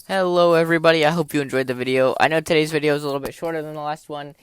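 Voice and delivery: loud, bright YouTuber sign-on; upbeat outro greeting. Acted. Youtuber opening the outro for viewers, loud and upbeat.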